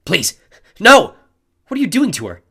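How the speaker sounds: loud frantic demand; boy demanding the bully stop the attack. A narrator's reading. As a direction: shout at him to stop. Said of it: "I demanded".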